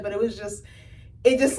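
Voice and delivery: ordinary talk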